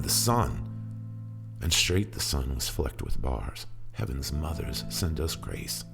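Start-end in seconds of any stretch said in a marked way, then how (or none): none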